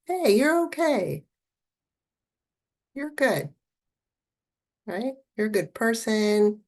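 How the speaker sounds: inner soother voice. Pretend. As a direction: reassure gently, like comforting a hurt friend. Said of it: "Can you imagine a voice that says".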